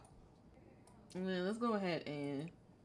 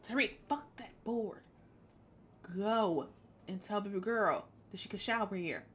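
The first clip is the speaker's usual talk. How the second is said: ordinary talk, nothing marked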